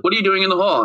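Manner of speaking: a hint of a suspicious mom; mildly mock mom voice; mom questioning. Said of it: "and she's like".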